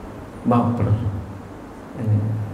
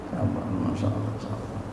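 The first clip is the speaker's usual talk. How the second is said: soft whispered plea for patience; whispered sabar; 6 semitones lower, 10 dB quieter than usual. Real, not acted. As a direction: breathe the word sabar gently.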